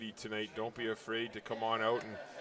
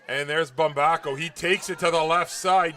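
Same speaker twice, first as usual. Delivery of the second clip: loud, raised, excited football call; live play-by-play; 4 semitones higher, 12 dB louder than usual. Real, not acted. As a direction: call the breakaway run as it unfolds.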